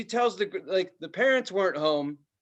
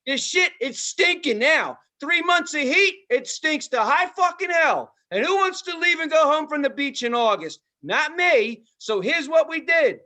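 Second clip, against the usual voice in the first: escalating rant; 10 semitones higher, 5 dB louder than usual. Retold or imitated. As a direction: wind up the outrage bit by bit. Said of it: "she's like".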